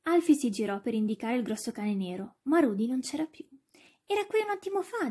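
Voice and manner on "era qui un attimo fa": much higher, bewildered remark; boy's high-pitched puzzlement; puzzled searching. A narrator's reading. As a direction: say it baffled, looking for the lost dog. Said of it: "disse cercandosi intorno con lo sguardo".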